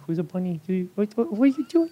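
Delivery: put-on high cooing baby-talk voice; baby talk to daughter. Dad cooing at his baby daughter at home, high baby talk. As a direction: coo it up high like talking to a toddler. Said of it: "be home and be like".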